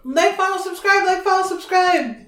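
high, loud repeated chant; yelled plug. Pretend. Host plugging the podcast first, yelled chant. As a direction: yell it out high like a hype plug. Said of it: "Stop yelling at people".

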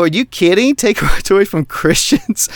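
high, outraged disbelief with a laugh breaking in; a retold incredulous outburst. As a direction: retell the outrage, half laughing. Retelling outraged reply to nurse.

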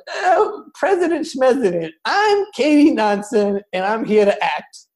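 a hint of a pompous stage actress; haughty actress impression. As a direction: play her as a vain diva brushing off the tragedy. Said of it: "when you were like".

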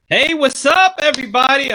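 high, loud hype greeting; excited greeting. Real, not acted. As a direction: fire up the audience.

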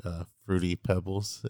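normal talking voice

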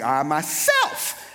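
"myself" drawn out and leaping into a high squeak; slow "I", then shoot "myself" up high; high pitch on MYSELF. Shown as a demonstration. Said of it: "had that high pitch".

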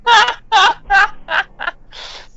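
high, loud burst of real laughter. Real, not acted. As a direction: crack up at the joke. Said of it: "You had me there for a second".

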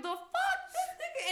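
high, incredulous the fuck; disbelief. Retold or imitated. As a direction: sound baffled and grossed out. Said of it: "I was like".

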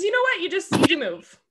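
breezy brush-off. Acted. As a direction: throw it out high and loud. Mock resigned advice, pitched up.

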